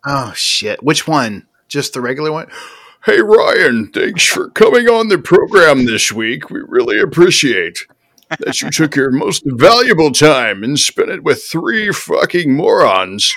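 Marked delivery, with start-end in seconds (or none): 3.1-13.3 loud, higher put-on host voice; Quakes impression. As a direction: thank the guest in a hammed-up Quakes voice. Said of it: "You gotta do this in quakes".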